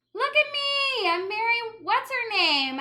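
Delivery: high, loud bravado shout; hollering. Acted. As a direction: holler it out, faking bravery. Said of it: "I hollered, trying to sound brave".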